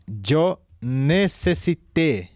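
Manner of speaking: necesité drawn out slowly, stress on the final té; slow dictation. Shown as a demonstration. Teacher dictating slowly to students who are writing it down. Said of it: "En pasado perfecto simple".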